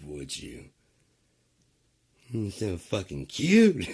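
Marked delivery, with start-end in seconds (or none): 2.3-3.9 loud, higher, slowly drawn out gush. Real, not acted. Streamer gushing at viewers, loud and slow. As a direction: gush over them, half mocking. Teasing the chat.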